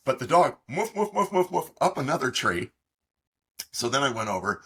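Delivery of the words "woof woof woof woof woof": high dog barks through a laugh. Retold or imitated. Imitating the dog chasing the bear up a tree, laughing. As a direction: yap it out while laughing.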